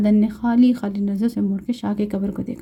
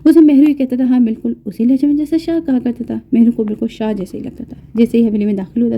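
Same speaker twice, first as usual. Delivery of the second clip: ordinary delivery, nothing marked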